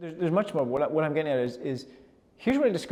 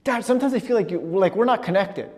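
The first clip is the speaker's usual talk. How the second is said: higher, louder and pointed; aggressive child; 7 semitones higher, 8 dB louder than usual. Retold or imitated. Seven-year-old son confronting his dad, aggressive and loud. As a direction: push it at Dad like an upset kid. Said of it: "really aggressive".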